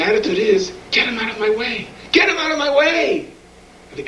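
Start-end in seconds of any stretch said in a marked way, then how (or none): 0.9-1.9 raised pitch, acted impatience; impatient dismissal. Voicing the attitude toward difficult people, impatient and higher. 2.1-3.3 high-pitched acted exasperation; mock fed-up cry, pitched way up; exasperated outburst. Voicing the attitude toward difficult people, exasperated high cry. Repeats intolerant attitude, escalated.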